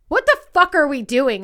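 retold exasperation, pushed up and loud; exasperated husband. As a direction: imitate a fed-up husband. Retells husband's exasperated question.

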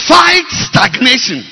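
loud, high, slow; shouted slogan. Real, not acted. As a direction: drive the point home. Repeated sermon point.

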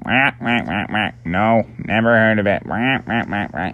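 short duck quacks around the words; quacking ducks. Acted. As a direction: voice a pond duck, quacking between phrases. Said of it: "all the ducks flapping around in the lake were like".

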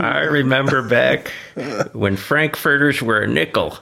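lightly voiced old-timer reminiscing; hint of a creaky old-timer; old-timey voice. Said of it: "I should be talking with an old-timey voice".

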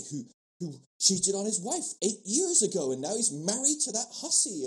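high, loud church-lady outrage; mock scandalised gossip. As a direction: imitate a shocked small-town gossip.

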